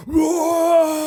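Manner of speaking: high, drawn-out mock roar; imitated disgusted roar. Friend mimicking Dunk shaking a burger van awning, mock roar. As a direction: imitate someone roaring as they rattle things. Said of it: "to express his disgust ... started going".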